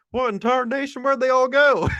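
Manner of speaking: hammed-up hillbilly bewilderment; baffled Cletus. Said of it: "I could just see Cletus going back down and be like".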